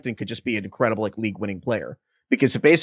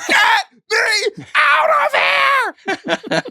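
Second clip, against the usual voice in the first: very high, slow crying shriek; Trey Hendrickson as trapped old lady; 4 dB louder, much slower than usual. Retold or imitated. Hendrickson begging to escape the Bengals, crying shriek. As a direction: wail it like you're trapped. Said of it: "He's like".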